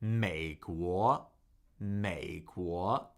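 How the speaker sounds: low 美, then rising 国; tone demonstration. Shown as a demonstration. Teacher modelling America in Chinese, 美国 low then rising. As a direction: drop 美 low and lift 国 upward. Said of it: "Third tone, second tone".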